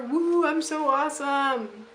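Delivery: mock excitement. Mock cheer about her license.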